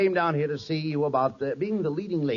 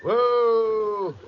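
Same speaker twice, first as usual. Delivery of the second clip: calling whoa; 6 semitones higher, much slower than usual. Acted. Reining in a horse.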